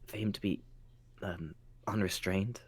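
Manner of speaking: very soft, hushed question; tentative undertone for one listener only; confidential whisper. Acted. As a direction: murmur it secretly, picking words carefully. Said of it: "so he can just whisper something".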